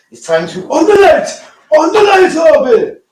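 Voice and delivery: high, loud call across the house; loud call to switch on the light. Real, not acted. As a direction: shout it out to Harvey. Calling out to Harvey.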